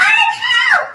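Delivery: high, loud shriek for help; screamed. A narrator's reading. Missus Twit panicking on the supposed ceiling, screamed. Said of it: "screamed Missus Twit".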